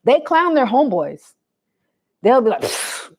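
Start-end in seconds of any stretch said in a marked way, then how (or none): 2.6-3.2 quiet dismissive puff of air; soft "pfft" under the breath; scoff under the breath. Retold or imitated. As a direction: imitate a muffled snicker, barely audible. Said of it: "under their breath a lot".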